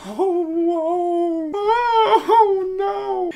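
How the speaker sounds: high, hammed-up fake crying over nothing; mock wailing. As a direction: wail in mock terror, like it's the end of the world. Mock panic at the threat.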